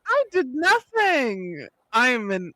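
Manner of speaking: high, slow I did nothing, playing innocent; mock innocence. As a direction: fake innocence, drawn out high.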